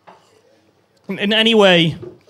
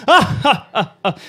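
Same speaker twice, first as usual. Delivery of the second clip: mock belly chuckle; 3 semitones higher, 3 dB louder, slower than usual. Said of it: "they always do it like".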